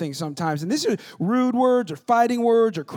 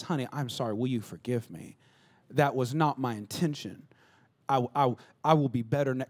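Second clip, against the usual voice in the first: lower, softer sincere apology; 8 semitones lower, 5 dB quieter than usual. Shown as a demonstration. Husband humbly apologising to his wife, soft and sincere. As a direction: own it softly and mean it. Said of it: "y'all see the difference?".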